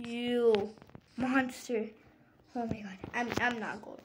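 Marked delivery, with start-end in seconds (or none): none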